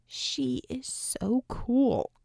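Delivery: slow, awed whisper; fanboy whisper. Acted. Fan whispers about his hero, awed whisper. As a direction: breathe it out in awe. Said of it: "Acrobat whispered in fanboy fashion".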